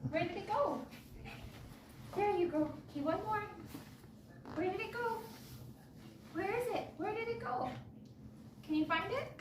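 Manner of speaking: high soft pet voice; talking to a dog. Real, not acted. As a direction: coo while he hunts the treat. Playing treat game with dog.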